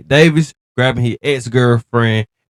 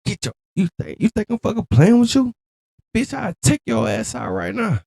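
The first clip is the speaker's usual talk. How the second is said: imitating furious threats; 10 semitones higher than usual. Dubbing [name]'s threats in video.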